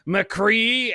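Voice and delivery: high-pitched hammed-up Southern drawl; mock Southern belle calling out, pitched high; fake Southern accent. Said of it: "this really fake Southern accent".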